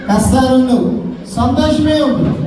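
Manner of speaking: normal talking voice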